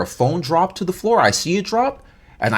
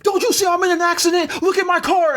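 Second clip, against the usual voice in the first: mock indignant, much higher; high-pitched imitation of her ranting. BMW lady ranting at him about her accident, mock indignant and high. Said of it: "she starts going on some rant".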